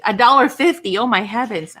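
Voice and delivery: loud, incredulous exclamation; playful outrage, hit hard; mock disbelief. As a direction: balk loudly at the price. Reacting to a laughable price offer.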